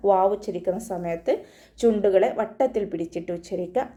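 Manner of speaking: normal talking voice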